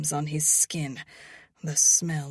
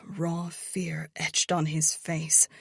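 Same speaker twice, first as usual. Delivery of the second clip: usual speaking voice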